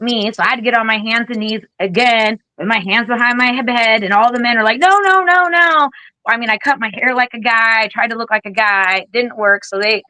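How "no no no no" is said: high, loud rapid no's; alarmed refusal. Retold or imitated. Men at the bomb dump refusing the girl, alarmed. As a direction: cut her off in alarm.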